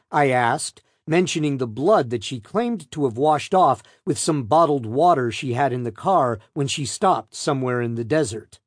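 usual speaking voice

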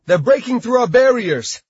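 high, loud alarmed yell. A narrator's reading. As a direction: yell the danger to the guild. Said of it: "Dwayne yelled".

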